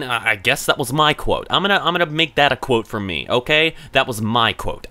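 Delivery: ordinary talk, nothing marked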